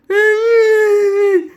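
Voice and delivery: loud, high fake bawling; wordless squealing sob, hammed-up; mock crying wail. Shown as a demonstration. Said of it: "they cry like that?".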